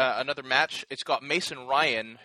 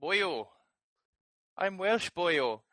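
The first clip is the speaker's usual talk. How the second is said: a higher-pitched, hammed-up Welsh lilt; lightly voiced Welsh big man, teasing; mock Welsh accent; 5 semitones higher, much slower than usual. Mocking how the Welsh wrestler sounds, in a mild higher Welsh accent. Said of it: "big burly motherfucker who sounds like".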